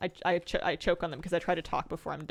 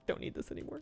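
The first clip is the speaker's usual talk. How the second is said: hushed viewer voice; 8 dB quieter than usual. Acted. Voicing viewers giving up merch.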